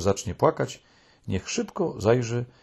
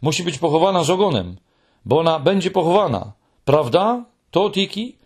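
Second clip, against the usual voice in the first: upset pleading; 7 semitones higher, 4 dB louder than usual. A narrator's reading.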